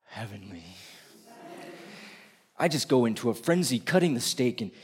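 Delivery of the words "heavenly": soft, low sigh of pleasure; savouring hush. Acted. Savouring the steak alone, a soft blissful hush. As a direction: breathe it out like a perfect bite.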